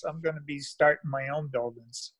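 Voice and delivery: ordinary talk, nothing marked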